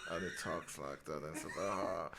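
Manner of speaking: very low, soft murmur. Shown as a demonstration. Actor wooing [name] in a parody, low murmur. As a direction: sink the voice deep and quiet. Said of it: "a particular choice in how he speaks".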